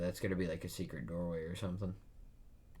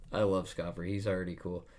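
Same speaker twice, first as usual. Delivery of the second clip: normal talking voice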